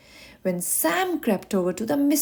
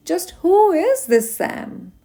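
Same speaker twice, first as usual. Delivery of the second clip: puzzled wondering; 7 semitones higher, 4 dB louder, slower than usual. Acted. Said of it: "she thought to herself".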